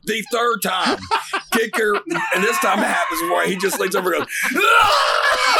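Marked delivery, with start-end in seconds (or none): 4.6-5.6 high, loud imitated scream. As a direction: burst into a hammed-up shriek. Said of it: "just starts screaming again".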